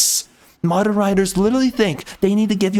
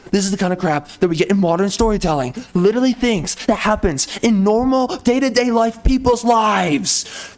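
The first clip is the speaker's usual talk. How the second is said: ordinary talk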